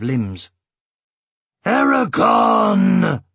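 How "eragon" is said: loud, high, drawn-out shout of alarm; crying out. A narrator's reading. Saphira cries out to fallen Eragon in concern, loud urgent shout. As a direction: call his name out loud, stretched and urgent. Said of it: "cried Saphira".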